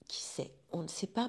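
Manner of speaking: qui sait, on ne sait pas, soufflé tout bas; chuchoté. Real, not acted. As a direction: chuchote comme un secret taquin. Confidence taquine à son public. (murmure complice au public)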